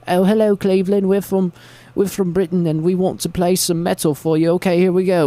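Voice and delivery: high-pitched mock British frontman; British accent. British band greeting Cleveland crowd, mock British accent. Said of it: "they're, like, British guys".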